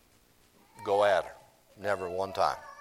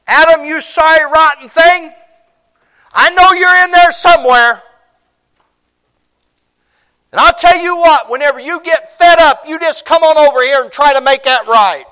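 high full-voiced yell across the garden; bellowing down the garden; God yelling; 20 dB louder than usual. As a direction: call him out loud and high from far off. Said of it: "He could have yelled that".

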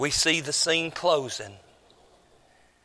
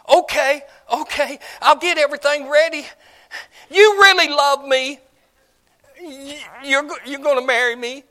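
high, loud, fast gushing; excited bride; 9 semitones higher, 7 dB louder than usual. Acted. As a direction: gush like a girl just promised marriage. Bride excited at shepherd's promise.

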